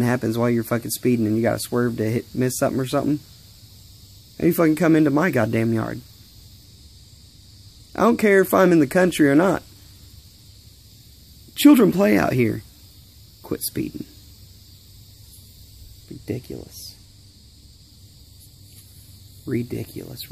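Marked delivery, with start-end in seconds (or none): 4.4-12.6 raised angry voice; angry rant. Real, not acted. Ranting at speeders. 13.4-20.3 whispered, much softer mutter; hushed disgusted mutter. Real, not acted. Muttering under breath.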